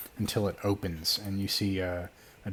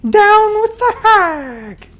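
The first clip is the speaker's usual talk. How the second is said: very high, slow, loud shriek; shrieking flower; 15 dB louder, much slower than usual. Acted. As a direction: screech it like a tiny monster. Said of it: "And the flower goes".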